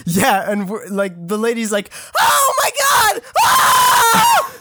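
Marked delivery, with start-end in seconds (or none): 2.2-3.2 very high, hammed-up shriek; screamed imitation of someone freaking out; very loud mock scream. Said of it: "That was so loud". 3.4-4.6 long, piercing high-pitched shriek; full-volume imitation of a panicked scream; hammed-up scream of terror; very loud mock scream. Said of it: "That was so loud".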